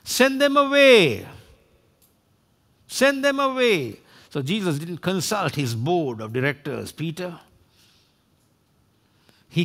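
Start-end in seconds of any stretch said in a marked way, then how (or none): 0.0-4.0 hungry impatient shout. Acted. Hungry disciples want the crowd gone, high impatient shout. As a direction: call it out high, as if hunger is talking. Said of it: "it was their hunger that was speaking".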